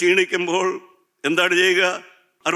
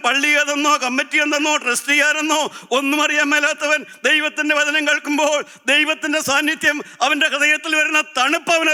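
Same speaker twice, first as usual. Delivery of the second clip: high-pitched, impassioned pulpit cry; full-force preaching; 12 semitones higher, 4 dB louder than usual. Real, not acted. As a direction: preach it high and relentless, driving each phrase to the congregation. (ഉയർന്ന സ്വരത്തിൽ ആവേശത്തോടെയുള്ള പ്രസംഗം)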